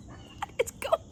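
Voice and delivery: breathless frightened "What is going on?"; scared whisper. Retold or imitated. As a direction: whisper it, high and terrified. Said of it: "I'm like".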